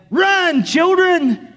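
high, full-volume mock scream of alarm; panicked yelling. Mother freaked out by skunks warns her children, yelling. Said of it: "she just started yelling, screaming".

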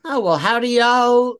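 a hint of a Texan drawl, drawn out; half-started Texan drawl, slow; brief mock Texan drawl. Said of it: "wanted to do a George W. Bush drawl".

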